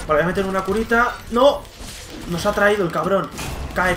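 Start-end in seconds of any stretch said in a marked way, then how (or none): 1.3-1.6 startled shout. Real, not acted. Enemy catches him in game.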